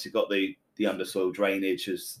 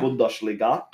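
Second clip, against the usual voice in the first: "Bundesliga" as "Boondesleega", German sh; German pronunciation; 4 dB louder, slower than usual. Shown as a demonstration. Host repeats it, praised for the accent. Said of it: "Nice accent".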